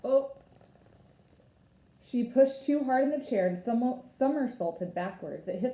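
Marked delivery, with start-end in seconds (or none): none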